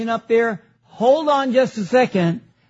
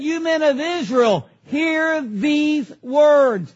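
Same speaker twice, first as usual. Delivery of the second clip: slow, separated "hear these words"; emphatic address; 2 semitones higher, slower than usual. Acted. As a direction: quote it as a proclamation, stretching the ending. Repeating Peter's address for emphasis.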